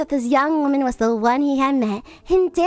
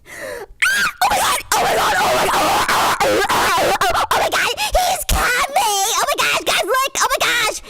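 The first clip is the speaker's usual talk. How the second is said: very high excited squeals; shrieking stepsister; 11 semitones higher than usual. A narrator's reading. Stepsister at the window, shrieking with excitement. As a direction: squeal like a giddy girl. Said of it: "shrieked one stepsister".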